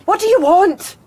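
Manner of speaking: loud, high challenge; angry shout. Acted. Woman confronting Hyde at her door at night, angry shout. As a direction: yell it at an unwelcome caller. Calls angrily to night caller.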